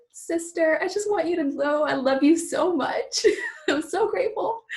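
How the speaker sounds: high, emotional imitation of a grateful friend with a laugh; imitating a teary friend. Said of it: "send me voice messages and be like".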